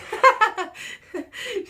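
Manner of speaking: loud, very high cackle; amused laugh. Real, not acted. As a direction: burst out laughing at the joke. Amused by trainer's joke.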